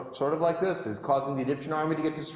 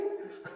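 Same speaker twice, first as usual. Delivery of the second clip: short scoffing laugh; brief laugh of disbelief; 10 dB quieter than usual. Acted. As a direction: laugh them off. Moses scoffs.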